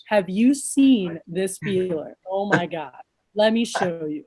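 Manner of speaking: low, slow mock awe; laid-back amazement. Shown as a demonstration. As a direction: demo understated wonder, stretching the words out. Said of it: "a laid back person, you gotta use your nonverbals".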